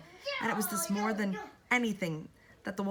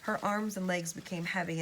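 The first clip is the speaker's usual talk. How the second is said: usual speaking voice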